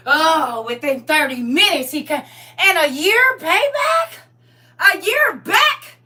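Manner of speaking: high, slow, stretched-out astonished question; can't believe the back pay; amazed disbelief. Real, not acted. Scene: the back pay came through, she's asking in astonishment.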